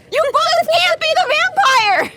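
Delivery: high, drawn-out protest; exasperated exclamation. Real, not acted. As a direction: wail in comic disbelief. Confused listener protests casting.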